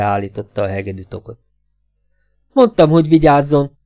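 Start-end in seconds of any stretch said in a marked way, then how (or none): 2.5-3.8 alarmed cry. Acted. Kocsubej fears for his violin.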